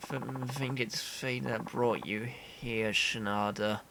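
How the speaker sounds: drained, stammering, running out of strength; starving weak man. Acted. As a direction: play someone too weak from hunger to move.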